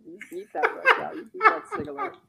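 laughing through mock praise. Real, not acted. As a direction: giggle out the teasing words. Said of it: "laughs through mock praise".

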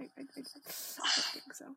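stammered, trailing whisper. Real, not acted. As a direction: fade into a breathy whisper.

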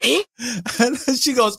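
slow, high, laughing through words. Real, not acted. Retelling her walk to the mirror, laughing slowly.

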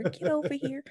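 teasing copy of a hushed battle cry; mock whisper. Co-host mocking friend's quiet version, mock whisper. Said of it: "You whisper".

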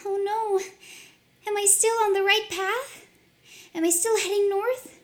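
high, anxious questions; lost and fretting; worried girl. Acted.

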